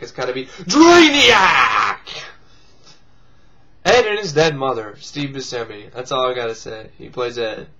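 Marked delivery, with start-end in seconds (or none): 0.0-2.0 drawn out, loud and punched like a movie title with an exclamation point; emphatic exclamation. Shown as a demonstration. Riffing on a movie title, shouting it out with emphasis. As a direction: belt the title out as if it ends in an exclamation mark. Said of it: "it can't be just Drainiac".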